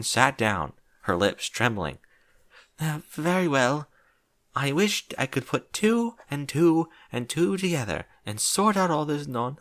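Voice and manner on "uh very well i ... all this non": reluctant Rarity. Acted.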